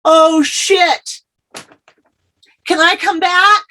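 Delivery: high, alarmed oh-no moment; dismayed realization. Retold or imitated. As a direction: realize the mistake and beg to undo it. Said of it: "And I went".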